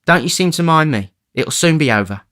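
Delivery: put-on exhausted girl near fainting; despairing. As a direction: give up sadly. Nancy fading.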